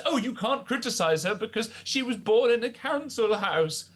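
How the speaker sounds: high, exasperated mock protest. Radio guest mimicking an opponent's defenders, mocking and high. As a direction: parrot the critics' excuse, pitched well up. Mocks critics' excuse.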